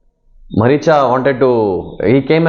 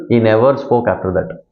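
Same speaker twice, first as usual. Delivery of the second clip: normal talking voice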